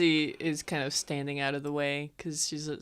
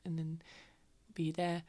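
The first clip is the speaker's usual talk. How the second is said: reassuring in character; 5 dB quieter than usual. Acted. Tavamil coaxes injured Lucian to keep walking, soft reassurance. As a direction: gently coax a friend along. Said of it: "at first was just being like".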